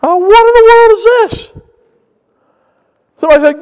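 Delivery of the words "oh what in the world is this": high-pitched startled exclamation; bewildered outcry at a sudden quake; retold astonishment. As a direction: exclaim in alarm, acting out the shock. Retelling own shock at earthquake.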